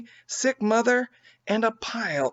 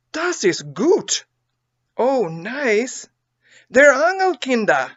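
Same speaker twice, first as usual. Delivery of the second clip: higher, louder German exclamations; German children crying; 8 semitones higher, 5 dB louder than usual. A narrator's reading. Hungry German children thanking their helpers. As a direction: cry out happily while eating. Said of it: "cried the poor things".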